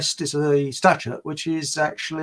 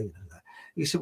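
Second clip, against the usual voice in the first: ordinary delivery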